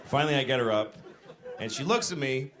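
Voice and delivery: normal talking voice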